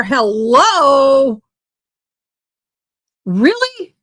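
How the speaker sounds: incredulous, pitch leaping up on "Really?"; exasperated disbelief. Real, not acted. Commentator reacting to officers standing by with shields, exasperated disbelief. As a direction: call out a pointed, disbelieving question.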